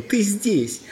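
high-pitched delighted exclamation; jubilant. A narrator's reading. Prince greets his lost friend, jubilant. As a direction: exult at seeing a friend. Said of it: "ликовал он".

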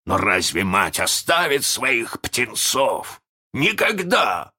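slow, rising, emotional declamation; impassioned captain. A narrator's reading. Hook speaks of the faithful mother bird, impassioned. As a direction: build to a high, drawn-out cry. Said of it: "Голос его дрогнул".

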